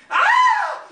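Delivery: very high, loud imitated scream; sudden piercing scream; high-pitched scream. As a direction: shriek like the terrified wife. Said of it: "Myra screams".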